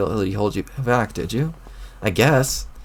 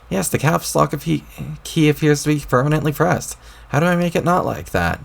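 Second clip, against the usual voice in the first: put-on higher, peevish young troll snapping back; irritated troll boy; 6 semitones higher than usual. A troll boy talking back to the voice in his head, irritated.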